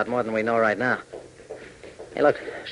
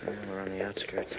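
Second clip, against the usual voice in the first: whispered, softer reply; 10 dB quieter than usual. Acted. Hop answering Tank while tailing her, whisper. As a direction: whisper it so no one hears. Sneaking near the suspect's building.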